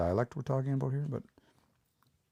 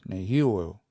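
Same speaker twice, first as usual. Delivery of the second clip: Nehioeo, stressed on the second syllable, hi; stress moved over one; 3 semitones lower than usual. Shown as a demonstration. Teacher has learners repeat Nehioeo, stress on hi. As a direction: lean on hi in Nehioeo and let the rest fall. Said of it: "say it exactly the way you hear it".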